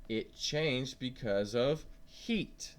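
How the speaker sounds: slow, clear teacher model line; slow phrase for the class to echo back; slow model sentence. Shown as a demonstration. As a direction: say it slowly so kids can repeat it. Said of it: "Can you say that?".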